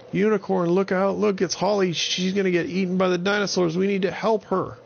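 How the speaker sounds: high, fast urgent call; urgent alarm. Acted. Avon calling to Unicorn to rescue Holly, high and urgent. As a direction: call out to save a friend. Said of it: "And Avon said".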